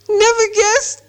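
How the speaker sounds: high indignant creature; Gollum voice. A narrator's reading. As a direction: squeal it high.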